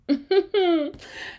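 high, quick giggle; real high-pitched laugh at yourself; embarrassed giggle. Real, not acted. Podcaster unsure of her facts, embarrassed giggle.

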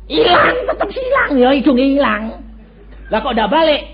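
high, loud shout that it's still gone; loud punchline shout. Acted. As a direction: shout it out to the congregation. Punchline, nose stays gone. (teriakan keras penuh penekanan)

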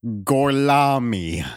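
slow, drawn-out fake Italian "Gorlami"; bad Italian accent. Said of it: "Brad Pitt tries to speak Italian".

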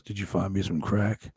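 lightly voiced low wrestler; a hint of a low-voiced impression; Jake the Snake Roberts. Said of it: "Jake comes up to me and goes".

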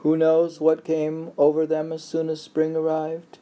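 high, lifted recital voice; poetry reading. Acted. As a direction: read it like a recited poem. Rereads poem at partner's request.

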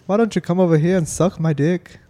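a jokey stage-Irish lilt; Irish accent. Pretend. Hammed-up Irish accent to his friends. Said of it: "I was doing an Irish accent yesterday".

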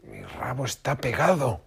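high, slow alarmed cry. A narrator's reading. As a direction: wail it in dismay. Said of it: "gritó".

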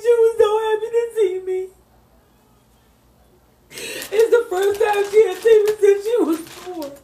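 real tears, voice high and breaking; crying while telling. Real, not acted. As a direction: fight through the tears as you tell it. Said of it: "Don't cry".

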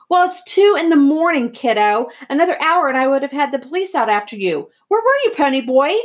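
loud, high-pitched scolding; heated, worked-up telling-off; angry, rising voice. A narrator's reading. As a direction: lay into him as a furious older brother. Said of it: "His voice was rising".

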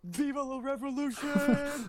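high, loud rebel cry; rallying cry. Pretend. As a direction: shout it like a revolutionary. Mock revolutionary cry.

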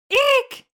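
very high shrill eek like a horror violin; shrieking eek. Pretend. Mimicking a slasher film stab for the co-host, high squeal. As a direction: shriek it like the Psycho strings.

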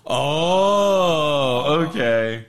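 long drawn-out, knowing "oh"; dawning realization. Retold or imitated. Private catching on to the hole in the plot, drawn-out realization. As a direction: stretch it out as the penny drops.

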